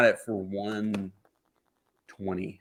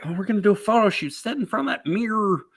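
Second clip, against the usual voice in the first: mocking producer voice; 10 semitones higher, faster than usual. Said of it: "They're like".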